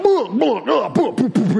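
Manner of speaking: loud, high-pitched flopping booms; comic imitation of a heavy tumble; imitated crashing and grunting. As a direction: boom it out like a whale flopping around. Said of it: "guttural, it sounded like a blue whale flopping around".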